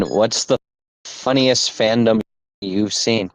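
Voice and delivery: ordinary delivery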